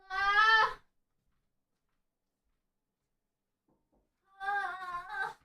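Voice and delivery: sharp cry of pain. Real, not acted. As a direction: yelp from sore muscles. Sore body, pained cries.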